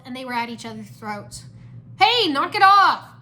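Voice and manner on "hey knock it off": high, loud, drawn-out order; shout. A narrator's reading. Kai breaks up the brawl, exasperated shout. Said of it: "Kai shouted".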